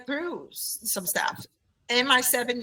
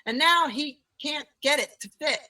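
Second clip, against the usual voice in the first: usual speaking voice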